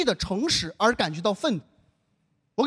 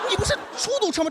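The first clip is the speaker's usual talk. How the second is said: fast, higher disbelieving question; incredulous; 9 semitones higher, faster than usual. Retold or imitated. Comedian baffled by the taxi driver, fast incredulous question. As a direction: rattle it off in disbelief.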